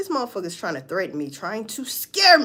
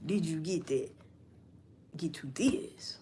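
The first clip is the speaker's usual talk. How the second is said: soft low whisper of both lines; whispered catchphrases; 4 semitones lower, 10 dB quieter than usual. Retold or imitated. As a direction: hush it like a secret. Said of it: "the way I said it".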